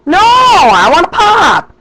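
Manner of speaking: high, loud, drawn-out protest; retold argument, whiny-high and slow; exasperated arguing. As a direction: insist at a high pitch, stretching the words. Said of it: "we got in an argument".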